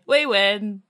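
loud, crowing victory cry; mock triumph. Gloating generals over their prisoners, loud triumph. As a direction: declare it like a gloating conqueror. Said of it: "as if to say".

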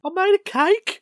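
high, loud Australian impression; mock Australian accent. Fan of Australian comedy imitating it, high mock Australian accent. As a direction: exclaim it in a hammed-up Australian accent.

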